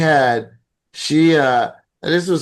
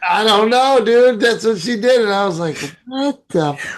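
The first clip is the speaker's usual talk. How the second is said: exasperated disbelief; 8 semitones higher than usual. Real, not acted. Telling his friend about mom's broken teeth, exasperated. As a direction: blurt it out in disbelief.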